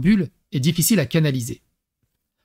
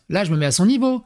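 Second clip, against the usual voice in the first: ordinary talk, nothing marked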